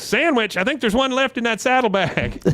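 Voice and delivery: high-pitched mock-female punchline; imitated chirpy wife. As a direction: sing out the reply high, as the wife. Voices wife's comic closing reply.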